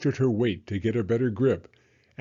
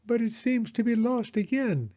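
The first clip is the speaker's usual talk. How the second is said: high, sheepish owl voice; an owl trailing off awkwardly; Owl's voice; 11 semitones higher than usual. A narrator's reading. Owl admitting the notebook is lost, high and sheepish. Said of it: "he finished".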